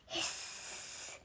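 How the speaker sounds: a soft voiceless hiss; vampire hiss. Acted. Vampire pupil hissing softly at the lesson. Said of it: "went the vampire".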